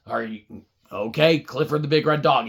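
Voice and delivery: ordinary delivery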